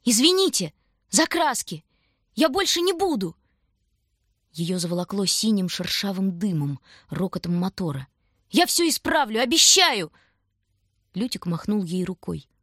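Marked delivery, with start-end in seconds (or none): 0.0-3.4 high, loud pleading cry; urgent, high-pitched wail of apology; yelled apology. A narrator's reading. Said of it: "И Таня завопила". 8.5-10.1 high, loud pleading shout; urgent cry, pitched way up; yelled promise. A narrator's reading. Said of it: "И Таня завопила".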